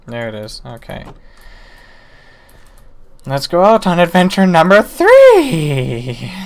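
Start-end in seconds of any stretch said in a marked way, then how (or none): 3.2-6.3 slow, loud grand announcement; mock-epic announcer; announcer-style declaration. Real, not acted. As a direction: proclaim the adventure like a trailer. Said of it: "announces next adventure".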